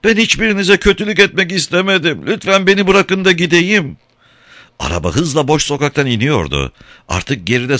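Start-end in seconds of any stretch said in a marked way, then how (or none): 0.0-4.0 very high, wailing voice; tearful plea. A narrator's reading. Katinka begging the sailors to release her, wailing. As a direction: beg in a high, whimpering voice. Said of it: "tekrar hıçkırmaya başlayarak".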